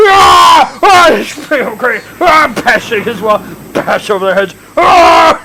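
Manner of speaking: high, loud wild shouting; raging screams. Acted. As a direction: scream while smashing things. Thugs smashing crate.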